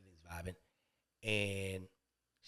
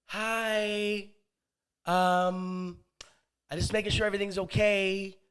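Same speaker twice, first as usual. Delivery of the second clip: higher, drawn-out tentative voice; hesitant neighbor; 4 semitones higher, slower than usual. Acted. Neighbor checking in through the door, hesitant. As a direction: stretch out each phrase in a raised voice.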